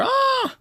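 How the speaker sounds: very high, loud falling-down yelp; acted cry of pain. Hero hitting the floor, high loud yelp. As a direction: let out a high, sharp scream.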